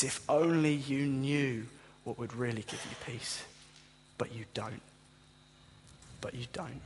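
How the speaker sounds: a hint of grief, sinking to near-quiet; tender sorrowful plea, fading out; mournful lament. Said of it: "he's weeping. He's crying".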